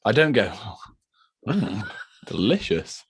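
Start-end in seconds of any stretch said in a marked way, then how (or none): 1.5-3.0 slow, drawn-out pretend relish; exaggerated slow yummy voice; mock savouring. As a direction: savour it like a tasty treat. Mock savouring of glue smell.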